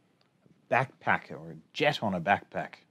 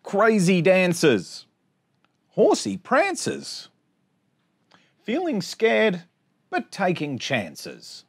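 bouncy sing-song rhyme reading; reading to children; 5 semitones higher, 7 dB louder, slower than usual. Acted. Teacher reading a rhyming picture book to her class, sing-song. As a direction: read the rhymes out brightly for little kids.

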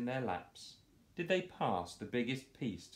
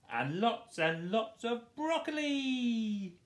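much higher, slow shriek; 4 dB louder, slower than usual. Acted. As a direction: shriek it out with delight. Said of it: "shrieks Doris".

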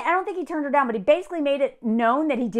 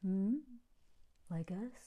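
fading to a much softer, lower sly question; sly insinuation; 3 semitones lower, 7 dB quieter than usual. Retold or imitated. Mimicking the women probing about close friends, slyly softening. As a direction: trail off softly into a knowing little question. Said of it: "They're like".

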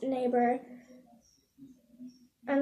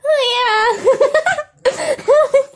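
high, squeaky pretend puppy voice through laughter; voicing the puppy; 10 semitones higher, 8 dB louder than usual. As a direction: give the dog a tiny silly voice. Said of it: "You said".

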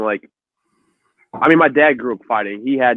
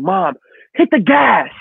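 higher, drawn-out urgent cry; mock urgent call; 6 semitones higher, slower than usual. Fleeing kid to mom in the car, urgent mock yell. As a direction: call out like a kid making a getaway.

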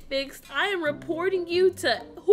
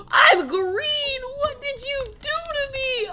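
panicked cry; 8 semitones higher, 3 dB louder than usual. Acted. Turned green by the doctor.

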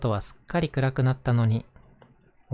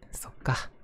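whispering; 4 dB quieter, slower than usual. Acted. Toys laugh at the doll.

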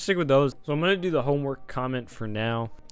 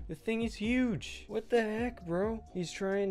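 freaking out; 8 semitones higher, 4 dB quieter, slower than usual. Real, not acted. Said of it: "Why am I freaking out?".

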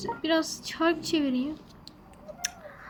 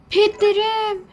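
gasp, then cry out the word higher and louder; sudden alarm over lost pets; shocked gasp; 5 semitones higher, 5 dB louder, slower than usual. Real, not acted. (şaşkınlıkla nefesi kesilerek)

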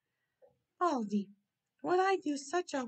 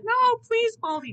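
high, loud begging; desperate plea; 10 semitones higher, 8 dB louder than usual. Acted. Baldy begging not to be changed, high and loud. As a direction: plead in sudden alarm.

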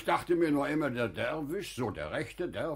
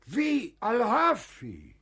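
surprised; 10 semitones higher, 5 dB louder than usual. Acted. Überraschtes Wiedersehen mit Freund.

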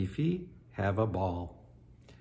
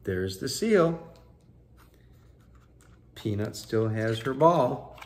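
put-on lively read-aloud for children; story narration; 3 semitones higher, 6 dB louder than usual. A narrator's reading. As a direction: tell it like a bedtime picture book. Reading picture book aloud.

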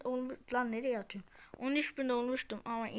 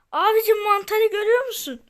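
exasperated; 7 semitones higher, 7 dB louder than usual. Real, not acted. Scolding a teammate.

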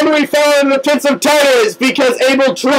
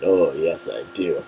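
much lower and much softer, sinking to a murmur; deep, fading Abel; 10 dB quieter than usual. Pretend. Abel assuring Hades, in a much lower voice fading away. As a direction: sink the voice right down as you agree.

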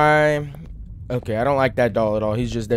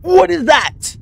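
high, loud frightened cry; real alarm at a sudden threat; 13 semitones higher, 8 dB louder, much slower than usual. Real, not acted. Player confronted by a creature, frightened cry. Attacked by something.

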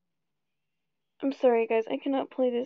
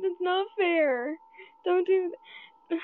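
whiny protest; 6 semitones higher, 3 dB louder than usual. Real, not acted. After two jump scares.

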